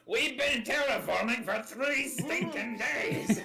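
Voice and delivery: slow, high mock shout; hammed-up desperate movie line; Lord of the Rings quote. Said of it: "What's that Lord of the Rings quote?".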